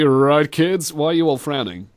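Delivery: ordinary talk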